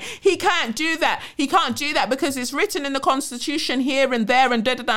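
high-pitched mock objection; mocking, high-voiced naysayers; mimicked protest. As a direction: imitate people protesting, pitched well up. Voices people naively objecting.

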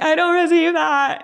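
high, drawn-out mock wail; mock heartbroken. Guest mimics herself insisting she is unbothered while shattered, mock heartbroken. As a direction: insist it through pretend heartbreak, voice way up. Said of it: "my heart will just be shattered".

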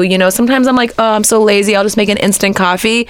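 ordinary talk

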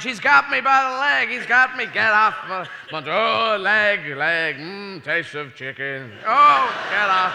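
loud high yelling while being grabbed; loud mock panic; hammed-up struggle. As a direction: fight off the monster, loud and high. Monster grabbing astronaut.

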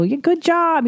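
put-on high-pitched cheer for a baby; gushing praise. Parent praising her little son, high gushing voice. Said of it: "we praise him a lot".

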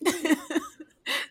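squealing with laughter, high and loud; laughing. Real, not acted. Retelling her husband's sibling question while laughing hard. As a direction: laugh hard through the words.

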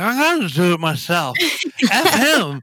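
loose, laughing tipsy slur; slurred drunk voice. Retold or imitated. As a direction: play it like a few beers in. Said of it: "with a slurry voice".

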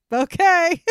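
high, loud startled "Okay"; a mock-startled little "Okay"; surprised okay. Mock reaction to club staying open.

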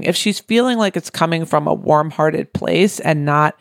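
usual speaking voice

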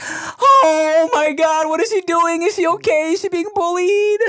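very high, hammed-up fretting; panicking parent. Parent frets over child at daycare, high-pitched panic. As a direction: spiral into exaggerated worry.

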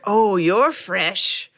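very slow, relishing British purr; scratchy British lady. Retold or imitated. Lady in hell sizing up fresh souls, slow British rasp. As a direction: savor the newcomers in a fabulous British rasp. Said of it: "fabulous, like, gross, scratchy, like, British delivery".

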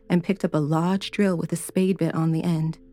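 ordinary delivery, nothing marked

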